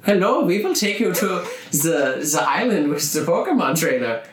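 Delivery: high, odd put-on sailor voice; a hint of a hammed-up Viking host; lightly voiced Viking crew calling to the kids. Viking ship crew greeting them.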